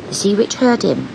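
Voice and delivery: ordinary delivery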